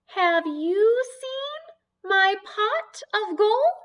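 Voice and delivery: high, loud, slow leprechaun pleading; squeaky leprechaun begging for his gold. Acted. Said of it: "sound like a leprechaun".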